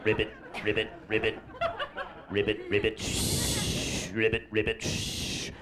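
soft, low ribbit croaks; frog croaking. Pretend. The first amphibian croaking, soft and low. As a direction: croak quietly like a little frog.

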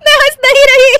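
high, loud, exasperated through laughter; laughing shout. Acted. Wife to husband, shouting she isn't laughing. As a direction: yell it out between giggles.

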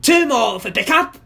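high-pitched villain holler; gleeful villain call. A narrator's reading. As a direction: call up to your henchman gleefully. Gargamel calls gleefully to bird.

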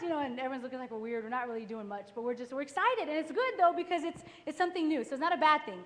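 usual speaking voice

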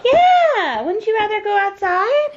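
bright excited cheer. Real, not acted. Excited mother to toddler.

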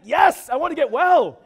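loud, high, emphatic cry; eager shout, punched hard on the yes. Acted. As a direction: belt it out with total conviction. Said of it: "give him an emphatic".

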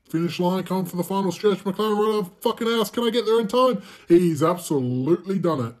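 loud, high play-by-play climbing to a peak; frantic race commentary. Pretend. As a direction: shout the final stretch like a sports commentator.

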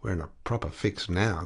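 ordinary delivery

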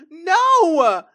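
a loud tearful wail of no; crying no. Real, not acted. Gamer grieving her Sim, crying out. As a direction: let the no break into a cry. Sim wife dies suddenly.

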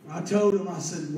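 usual speaking voice

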